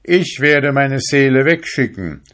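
put-on young fisherman calling out with joy; joyful cry. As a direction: cry out in delight, arms outstretched. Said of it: "rief er".

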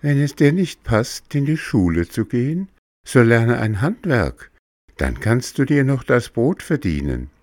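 high, serious little cricket; calm earnest advice. Acted. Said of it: "in ernstem Tone".

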